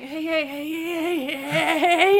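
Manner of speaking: repetitive cagey creature chant; lightly voiced jittery creature, repeating itself; acting out a twitchy troglodyte. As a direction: act out a creature stuck on one word. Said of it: "so he's just saying".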